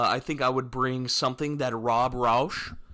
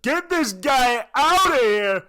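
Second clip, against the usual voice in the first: loud, high drawn-out jeer; mock heckling yell; 13 semitones higher, 10 dB louder, slower than usual. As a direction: yell it slowly across the room. Round table throws him out.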